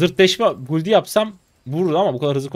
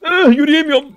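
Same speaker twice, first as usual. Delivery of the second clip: high, loud frantic complaint; frantic cry; 14 semitones higher, 11 dB louder than usual. Real, not acted. Streamer shouts he can't walk mid-fight, frantic. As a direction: cry out that you can't move.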